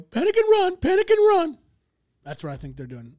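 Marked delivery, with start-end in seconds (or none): 0.0-1.6 high, loud panicked chant; shrill-pitched alarm call, repeated; panicking cartoon zebra. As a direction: cry out like a frantic cartoon zebra. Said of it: "zebras in that show go".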